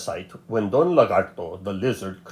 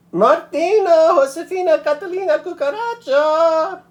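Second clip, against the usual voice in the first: loud, much higher full-name call; stern calling out; 5 dB louder than usual. A narrator's reading. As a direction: call her whole name like a scolding grandmother. Grandmother calls full name.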